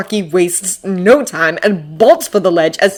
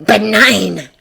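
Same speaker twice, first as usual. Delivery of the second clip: smug. A narrator's reading. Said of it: "the smugness in his voice".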